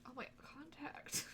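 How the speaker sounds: slow, breathless whisper. Real, not acted. Streamer in tears worrying about her contact, whispered. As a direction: hush it under your breath. Crying, contact lens slipping.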